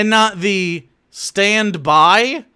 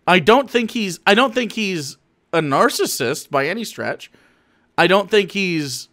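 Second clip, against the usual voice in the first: ordinary talk, nothing marked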